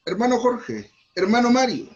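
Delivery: calling out names. Shown as a demonstration. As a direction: shout the names across the church. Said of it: "No le digo Jorge". (llamando a los hermanos en voz alta)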